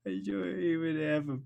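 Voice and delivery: high, slow, blubbering whimper; hammed-up weepy girl, high and slow; pitiful crying. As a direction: whimper it like it's the last straw. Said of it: "just ugly crying".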